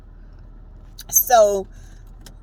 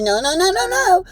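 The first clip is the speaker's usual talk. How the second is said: insistent protest; 10 semitones higher than usual. Retold or imitated. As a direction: protest harder with each no. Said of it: "She said".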